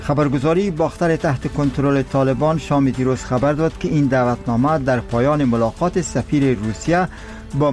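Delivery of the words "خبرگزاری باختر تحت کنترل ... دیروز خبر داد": iranian newsreader. Acted. Radio news bulletin read.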